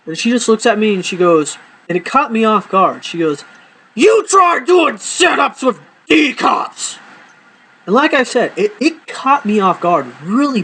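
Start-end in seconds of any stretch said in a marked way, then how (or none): 4.0-7.0 slow, high-pitched shouted retort; going off angrily. Retold or imitated. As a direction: imitate an exasperated friend's drawn-out yell. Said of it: "she literally just went off on me".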